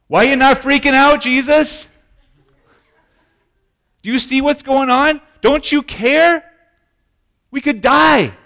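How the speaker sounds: high, loud, frantic shrieking; hysterical panic. Retold or imitated. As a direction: shriek it like panicked men in a sinking boat. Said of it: "probably with hysterical voices".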